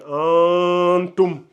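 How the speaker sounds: antum said slowly, the n held through the nose; nasalized antum. Shown as a demonstration. Teacher showing the correct nasal sound to students. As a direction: antum with a long nasal ghunna. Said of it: "au niveau des narines".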